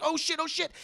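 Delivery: high, urgent exclamation; acted squeaky alarm; panicked Peter. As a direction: panic as danger builds. Peter sees gun about to explode.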